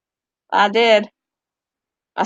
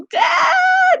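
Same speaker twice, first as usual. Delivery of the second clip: high yelled call for Dad; retold shout. Grown woman reenacting her childhood yell to Dad. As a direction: shriek for Dad as a scared kid. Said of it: "I was like".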